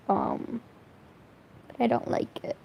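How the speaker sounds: ordinary talk, nothing marked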